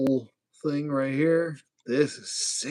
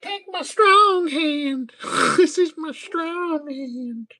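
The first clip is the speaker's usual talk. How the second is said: very high, slow, loud squeal; silly character voice; 6 dB louder, much slower than usual. Pretend. Host playing with toy's hand, high silly voice. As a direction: draw it out high and goofy.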